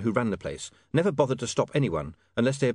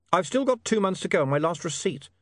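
lightly voiced William, pitched up; 7 semitones higher than usual. Customer talking to a voice in the dark, lightly voiced higher.

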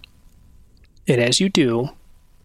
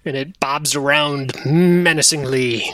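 usual speaking voice

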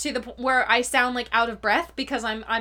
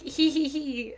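giggle; 2 semitones higher, 4 dB quieter, slower than usual. Retold or imitated. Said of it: "And I was like".

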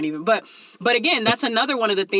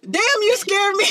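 put-on high, blunt exclamation in the kid's everyday talk; hallway talk; 12 semitones higher than usual. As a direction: blurt it the uncensored hallway way, pitched way up. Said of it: "we have heard how you talk in the hallways".

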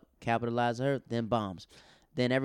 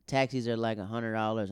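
ordinary talk

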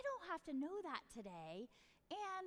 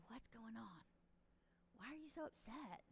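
put-on soft, gentle question to a child; quietly coaxing an upset kid; gentle comforting; 11 dB quieter than usual. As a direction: kneel down and ask softly.

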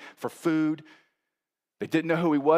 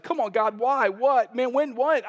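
pleading with God; 9 semitones higher, 5 dB louder than usual. Retold or imitated. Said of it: "he was just like".

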